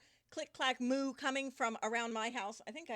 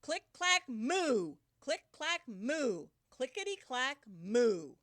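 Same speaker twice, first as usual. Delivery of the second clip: loud click clack moo for the class; loud read-along; 6 dB louder, slower than usual. Shown as a demonstration. Teacher leading the class chant, loud click clack moo. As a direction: lead the kids in the chant. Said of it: "Read it with me".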